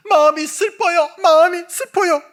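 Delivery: put-on child wailing to the counselor about her sadness; sad child. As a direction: cry out like a hurt little girl. Child client answers counselor.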